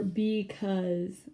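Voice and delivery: because, slow, weight on cause; be-CAUSE, lean on cause; stress on cause. Shown as a demonstration. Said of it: "the emphasis is on the cause".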